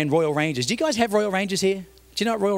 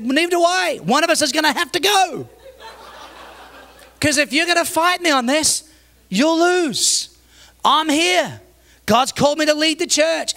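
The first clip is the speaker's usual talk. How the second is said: high, forceful ultimatum; retold pastor laying down the law; heated showdown; 9 semitones higher, 3 dB louder than usual. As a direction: stand your ground in a raised voice.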